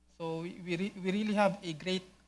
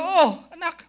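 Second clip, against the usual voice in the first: loud, high exclamation; acted, over-keen parent's reply; hailing one's child; 12 semitones higher, 11 dB louder than usual. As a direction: call back eagerly to your child. Acting parent answering a shouted call.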